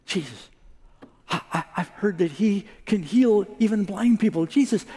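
high, breathless stammering with a laugh; hopeful excitement. Acted. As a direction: stumble over the words with rising hope. Beggar hopes for healing.

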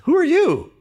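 very high, loud, startled demand; shocked father. Acted. Blind father to the son he didn't expect, shocked cry. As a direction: cry out in alarmed disbelief.

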